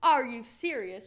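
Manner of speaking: loud, slow disbelief; drawn-out, indignant "are you serious"; incredulous. Retold or imitated. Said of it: "I'm like".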